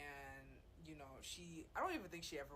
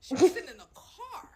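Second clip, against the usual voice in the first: much higher and louder, laughing through it; excited disbelief; 8 semitones higher, 16 dB louder than usual. Real, not acted. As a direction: build up in loud amused outrage. Retelling outrageous story, laughing.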